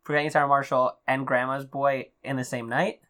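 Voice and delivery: normal talking voice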